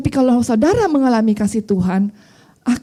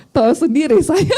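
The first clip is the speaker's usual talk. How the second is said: higher voice breaking into real laughter; laughing; 5 semitones higher than usual. Real, not acted. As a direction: say it and crack up. Laughs at own hot temper. (sambil tertawa lepas)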